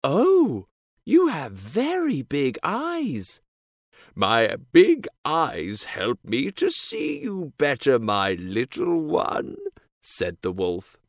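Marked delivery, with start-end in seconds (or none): 0.0-3.4 put-on high, slow amazed girl; surprised. A narrator's reading. 4.1-9.6 slow, sly wolf voice. A narrator's reading. Wolf's voice.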